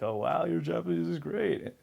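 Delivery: high, gushing fake praise; mock impressed local. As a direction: gush over his Japanese. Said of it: "or be like".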